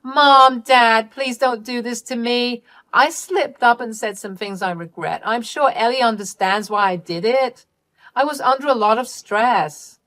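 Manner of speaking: high plea, loud on the first cries; pleading Candy. Acted. Daughter begging not to be disinherited, pleading. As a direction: beg them, then scramble for excuses.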